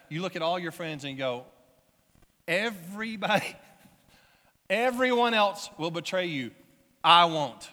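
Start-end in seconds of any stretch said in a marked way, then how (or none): 2.5-7.7 loud, acted declaration to the group; emphatic boast. Disciple standing up to all his friends, loudly emphatic. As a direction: proclaim it as a mock bold vow.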